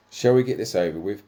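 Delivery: lightly voiced New Zealand accent; a hint of a New Zealand accent. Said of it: "with his New Zealand accent".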